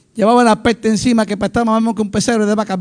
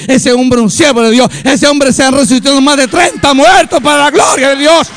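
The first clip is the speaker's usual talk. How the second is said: loud, raised testimony building to a cry; full-force preaching; 5 semitones higher, 4 dB louder than usual. Real, not acted. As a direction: proclaim it to the whole congregation in triumph.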